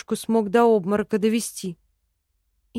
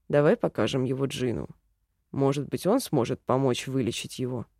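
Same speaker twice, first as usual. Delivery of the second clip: low, soft man's voice; elf man voice; 8 semitones lower, 4 dB quieter than usual. A narrator's reading. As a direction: suggest it low and calm. Elf character voice. (низкий спокойный голос эльфа)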